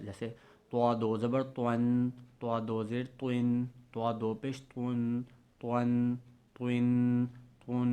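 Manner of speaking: توا said thick and heavy, تون with nasal ending; heavy nasal Arabic recitation; heavy full Toa. Shown as a demonstration. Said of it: "توا اور توا میں فرق رکھنا ہے".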